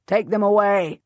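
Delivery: loud, curt order; snapped order. A narrator's reading. Salisbury ordering the captives away, snapping. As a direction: snap it at the guards. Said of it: "the Earl of Salisbury snaps".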